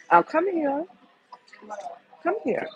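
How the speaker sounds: high call to someone across the room; sing-song come here to someone off camera. Real, not acted.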